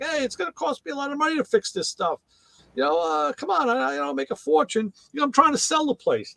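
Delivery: high-pitched wheedling complaint; pleading stand owner. Retold or imitated. Hot dog stand owner pleading with fire inspectors, wheedling. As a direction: imitate an owner talking his way out.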